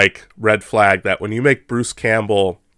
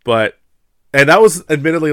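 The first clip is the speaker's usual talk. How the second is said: normal talking voice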